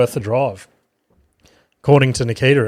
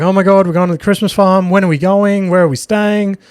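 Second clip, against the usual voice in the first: higher, gushing imitation of an excited wife; retold excitement; 6 semitones higher than usual. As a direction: rattle off questions like she can't wait. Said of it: "gets all excited".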